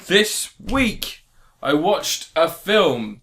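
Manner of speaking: slow, theatrical storyteller delivery; mock-grand introduction. Host unveils the film reviewed this week, mock-grand introduction. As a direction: announce it with pomp, pausing for weight.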